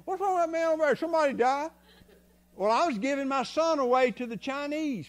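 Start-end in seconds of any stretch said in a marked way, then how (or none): 0.1-1.7 high-pitched imitation of startled bystanders; acted-out higher voice of puzzled onlookers; onlookers' questions. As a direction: voice gawking strangers, pitched well up. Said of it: "people was looking at me".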